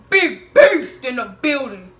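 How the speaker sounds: loud, slow proclamation; hyped announcement. Acted. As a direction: boom it out slowly. Hyped announcement amid retelling.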